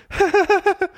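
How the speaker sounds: high-pitched giggle at someone; mocking laugh. A narrator's reading. Pinocchio laughing at the tangled kitten, giggling. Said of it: "pointed to the little kitten and laughed".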